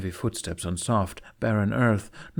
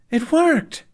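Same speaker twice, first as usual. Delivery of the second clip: loud, much higher joyful whoop; 8 dB louder than usual. A narrator's reading. Boy cheering to his friend after the spell, whooping loudly. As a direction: shout it out in triumph. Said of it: "Jamie whooped".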